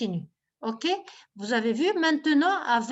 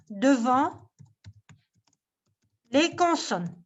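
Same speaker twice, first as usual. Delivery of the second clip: ordinary delivery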